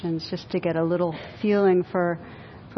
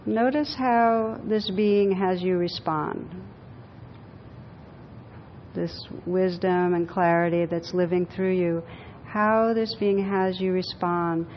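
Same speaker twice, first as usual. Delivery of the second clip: guided meditation; 3 semitones lower, 7 dB quieter than usual. Acted. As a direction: guide them gently inward, unhurried. Leads a guided visualization.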